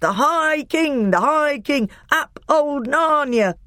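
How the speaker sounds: high, slow triumphant cheer; dwarf hailing the king, high slow joyful cheer; delighted cheer. A narrator's reading. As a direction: cheer like a dwarf dancing with joy. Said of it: "dancing with delight".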